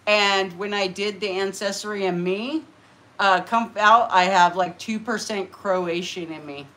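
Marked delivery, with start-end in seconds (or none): none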